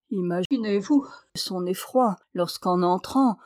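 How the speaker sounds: ordinary delivery, nothing marked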